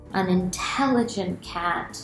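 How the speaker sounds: ordinary delivery, nothing marked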